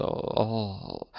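soft, appalled oh; hushed narrated gasp of dismay. A narrator's reading. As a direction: let the dismay sink away softly. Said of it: "con immenso sgomento".

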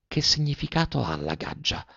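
a bassa voce, segreto; sottovoce. A narrator's reading. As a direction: chiedilo in un bisbiglio all'orecchio. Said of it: "le domandò sottovoce". (sottovoce, come un segreto)

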